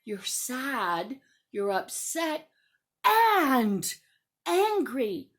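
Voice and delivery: very low, slow, deliberate adult voice; understanding teacher. Acted. Teacher naming a child's many emotions, patient. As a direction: list each one patiently, deep and measured.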